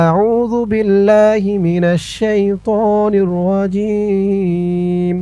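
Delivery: slow, drawn-out Quranic recitation. Shown as a demonstration. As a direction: recite each word slowly and melodically. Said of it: "চলুন দর্শক, শুরু করছি।". (ধীরে ধীরে তিলাওয়াতের সুরে)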